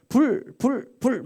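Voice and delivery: high, punchy imitation of a revivalist; mock repeated preacher's cries; revivalist shouting. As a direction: fire out each word like a revival preacher. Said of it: "막 외치면서".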